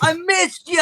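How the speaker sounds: very high-pitched reunion cry; elated, high squeal of welcome; overjoyed father. Acted. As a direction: gush it to a long-lost son. Father runs to his son.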